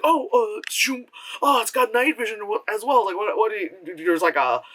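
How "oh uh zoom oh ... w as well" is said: mock excited guy. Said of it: "the guy would be like".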